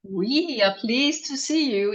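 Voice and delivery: ordinary delivery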